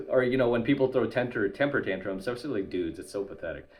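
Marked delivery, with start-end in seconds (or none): none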